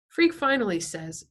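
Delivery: plain reading voice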